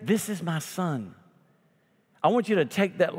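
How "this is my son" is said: voice from heaven. Acted. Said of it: "a voice from heaven came".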